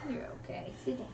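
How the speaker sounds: hushed, gentle reassurance; soft soothing whisper. Real, not acted. Mom soothing hurt son.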